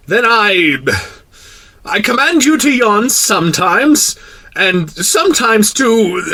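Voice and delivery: high, slow, faltering royal command; sputtering, annoyed king. A narrator's reading. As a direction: sputter orders as a flustered monarch. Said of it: "sputtering a little and seemed annoyed".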